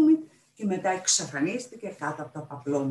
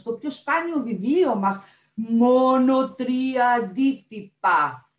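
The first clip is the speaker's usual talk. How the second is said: fussy guardian of a treasure, higher pitched; indignant pirate librarian; 6 semitones higher, 5 dB louder than usual. Acted. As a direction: refuse emphatically, hammering the key words.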